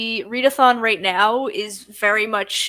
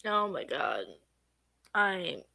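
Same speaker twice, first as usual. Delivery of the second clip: sleepy; 4 semitones lower, 11 dB quieter than usual. Real, not acted. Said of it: "I'm eepy".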